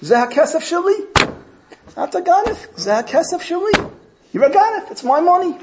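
furious high-pitched outcry; screaming accusation. Retold or imitated. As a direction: yell across the room that he stole it. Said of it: "screams out".

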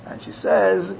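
usual speaking voice

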